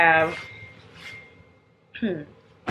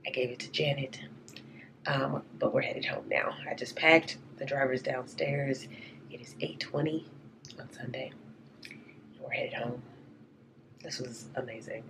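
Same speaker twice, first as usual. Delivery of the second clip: hoarse, lost voice after a concert; raspy whisper; 4 semitones lower, 6 dB quieter than usual. Real, not acted. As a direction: croak it out with no voice left. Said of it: "I have no voice".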